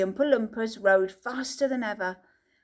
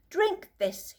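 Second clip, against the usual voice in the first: Mister Wonka offering; 9 semitones higher than usual. A narrator's reading. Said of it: "he said".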